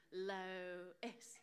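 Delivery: "Lois" split slowly as LOH, pause, ISS; syllable-by-syllable pronunciation. Shown as a demonstration. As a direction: teach "Lois" in two low, separate syllables. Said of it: "variations of pronunciation".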